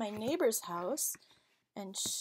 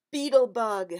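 much louder surprised reveal; excited reveal; 12 dB louder than usual. Acted. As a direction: announce the bug with delight. Reveal of beetle.

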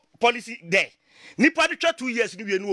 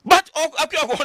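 raised rebuttal; 6 semitones higher, 5 dB louder than usual. Real, not acted. Heated rebuttal to critics.

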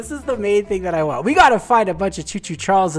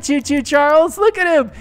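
ordinary talk

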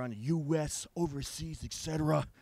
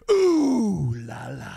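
drawn-out ooh la la; 2 semitones higher, 11 dB louder, much slower than usual. Acted. Drawn-out cry.

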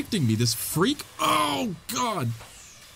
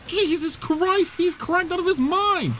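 higher, slow stunned outburst at the frantic boss; shocked; 14 semitones higher, slower than usual. Real, not acted. Player reacting to a berserk boss, shocked. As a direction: gasp it out in disbelief.

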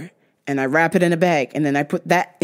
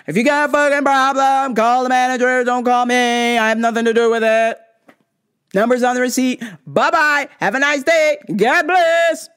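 yelled; 4 semitones higher, 4 dB louder than usual. Retold or imitated. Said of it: "And I yelled it".

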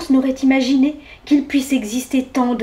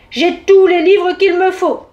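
loud, higher, slow and dry refusal; curt interruption; 6 semitones higher, 7 dB louder than usual. A narrator's reading. Bear cutting mouse off, curt and loud. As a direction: cut in sharply and loudly. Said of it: "l'interrompit sèchement".

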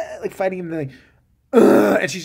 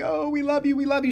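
much higher, lightly voiced fawning fans; mock gushing fans; 8 semitones higher than usual. Fans beg the writer for pictures, mock gushing. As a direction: gush like starstruck fans.

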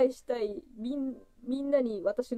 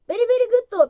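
louder, higher katakana English; Japanese-accented English; 9 semitones higher, 9 dB louder, much faster than usual. Retold or imitated. Recalling her broken English abroad, a bright, loud katakana "ベリベリグッド". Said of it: "とか よく言ってたけど".

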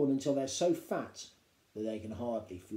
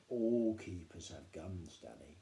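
slow, low and calm; father calmly telling Danny, low and slow; lower father voice; 7 semitones lower, 5 dB quieter, slower than usual. A narrator's reading.

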